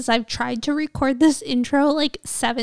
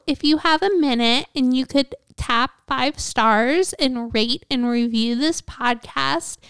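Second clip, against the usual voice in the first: normal talking voice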